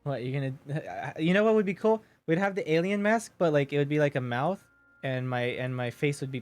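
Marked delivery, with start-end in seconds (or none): none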